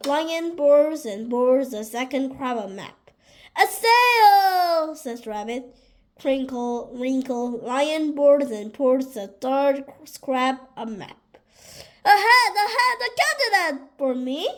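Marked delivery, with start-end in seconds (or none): 3.6-5.0 high, loud, drawn-out cry of discovery; excited exclamation. A narrator's reading. Rabbit showing Lion a sail, excited high exclamation. 12.0-14.5 high, fast, greedy delight; eager excitement. A narrator's reading. Rabbit grabbing for the captain's hat, high fast excitement.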